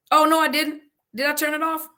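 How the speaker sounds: high, loud burst of alarm; alarmed disbelief. Real, not acted. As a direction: blurt it out high, hoping it isn't true. Checking if stream went off.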